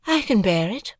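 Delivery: put-on a proud young woman answering lightly; Estella voice. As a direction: play Estella, poised and aloof.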